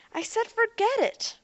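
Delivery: high, loud angry shout. A narrator's reading. Robot boy cutting off Ariel's question, shouting. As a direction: explode to shut her up. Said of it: "he shouted".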